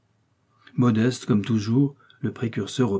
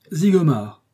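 higher, louder scoffing exclamation; loud sceptical outburst; exclaimed; 6 semitones higher, 5 dB louder, slower than usual. A narrator's reading. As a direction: toss the name out mockingly. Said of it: "s'écria-t-il".

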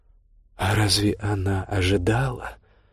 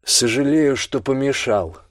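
metallic voice; 5 semitones higher than usual. A narrator's reading. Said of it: "раздался металлический голос".

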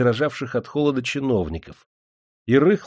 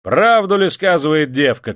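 loud, higher-pitched public question; shouting to a crowd; 6 semitones higher, 6 dB louder than usual. A narrator's reading. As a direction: call out so all the people hear. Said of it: "крикнул толпе Грязнов". (крикнул толпе)

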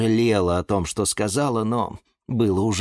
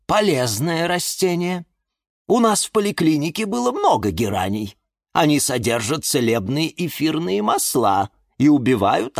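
instructive elder plant; 6 semitones higher than usual. A narrator's reading. Elder plant defends geranium.

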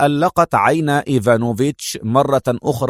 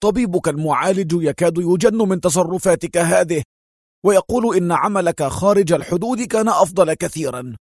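higher, sharp scolding; sharp rebuke; 5 semitones higher than usual. Acted. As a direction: scold him sharply like a worried boss. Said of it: "ثم استطرد في حدة". (توبيخ حاد)

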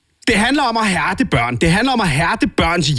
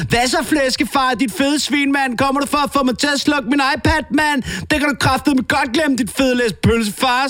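high, cheeky brat voice yelling insults; a bratty little sow mouthing off; foul-mouthed piglet; 8 semitones higher than usual. Acted. Piglet daughter taunting her dad in a high bratty voice. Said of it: "Sabrina Svin råber".

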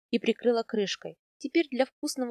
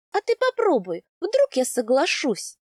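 much higher, louder teasing dare; coaxing challenge; 5 semitones higher, 5 dB louder than usual. A narrator's reading. Wife daring her husband to ask, high and teasing. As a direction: dare him playfully.

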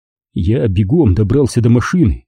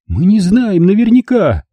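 higher, urgent mother's voice; pleading mother; 9 semitones higher than usual. Acted. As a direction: plead with him as his mother. Mother denying. (мать умоляет сына, высоким голосом)

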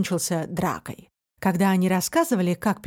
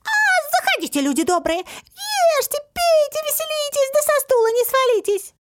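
very high, piercing rhymed welcome; squeaky shout. A narrator's reading. Little man gives in and invites guests, shrill cry. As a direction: cry it out as a tiny creature. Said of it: "топнул ногой и закричал".

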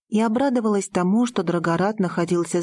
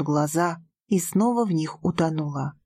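ordinary delivery

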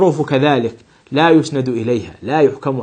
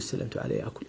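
"سلمت على يأكل" whispered, يأكل dropped low and softer; whispered wrong example; 5 semitones lower, 19 dB quieter than usual. Shown as a demonstration. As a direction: breathe the wrong phrase out as a rejected example. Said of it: "لا يجوز".

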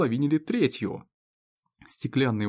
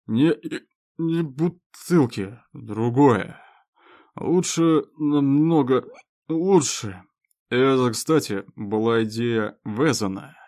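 put-on loud slow slurred drunk; town drunk; 5 dB louder, slower than usual. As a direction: boast like a proud drunk. Drunk owl insists.

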